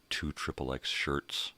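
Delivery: ordinary delivery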